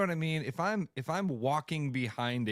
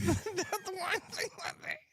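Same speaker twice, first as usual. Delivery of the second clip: laughing; 13 semitones higher than usual. Real, not acted. Still laughing while changing topic.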